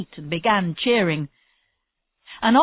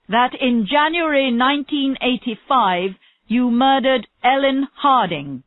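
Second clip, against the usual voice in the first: raised, formal courtroom proclamation; reading out charges; 5 semitones higher, 4 dB louder than usual. A narrator's reading. Court official charges Marlowe with murder, formal and raised. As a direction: pronounce the accusation firmly, higher and louder. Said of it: "the charges against him were read out".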